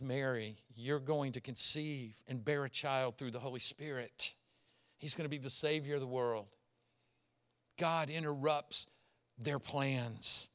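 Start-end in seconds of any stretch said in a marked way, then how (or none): 0.0-6.5 much softer, lower solemn announcement; hushed heavenly message; hushed angel. Acted. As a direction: deliver the news in awe.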